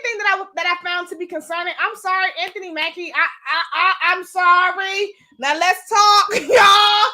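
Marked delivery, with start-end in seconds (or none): 5.4-7.1 louder, calling the audience in with a laugh; rally the viewers to dig in. Real, not acted. Launching into her critique.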